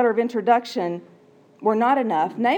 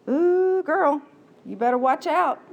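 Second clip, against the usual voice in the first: sassy servant; 6 semitones higher than usual. Acted. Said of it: "here's what I think it might sound like".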